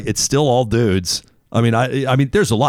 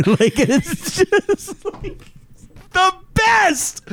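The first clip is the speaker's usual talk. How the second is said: laughing; much slower than usual. Real, not acted. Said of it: "laughing at the clip".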